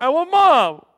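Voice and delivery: put-on loud, high crying kid; imitating a son in tears; bawling child. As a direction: wail for mom like an upset toddler. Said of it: "just bawling".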